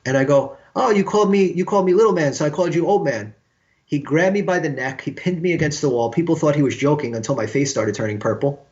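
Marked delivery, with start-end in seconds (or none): 0.7-3.3 high, loudish self-justifying retort; protesting. Retold or imitated. Younger musician answers the angry bandmate, protesting. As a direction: protest innocence, voice pitched up.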